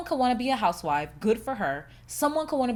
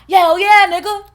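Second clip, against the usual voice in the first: loud, high, proud agreement; emphatic comeback; 9 semitones higher, 15 dB louder than usual. Retold or imitated. Answering friends who call her strict, loudly unapologetic. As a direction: fire back at full volume.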